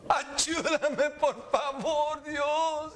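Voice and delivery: high, drawn-out sobbing wail; acted weeping plea. Preacher acts out Esau pleading for help, mock sobbing. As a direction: beg through exaggerated tears. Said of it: "aún con llanto".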